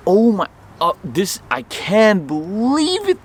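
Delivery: high, loud, drawn-out disbelief; replayed amazement. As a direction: relive the overjoyed shock, stretching the words. Replays amazement at recovered tweezer.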